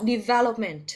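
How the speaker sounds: "development" stressed on its second syllable, "VEL". Shown as a demonstration. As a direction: model de-VEL-opment for "development". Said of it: "mình nhấn âm hai nào".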